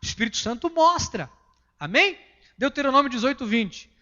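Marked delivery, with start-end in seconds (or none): none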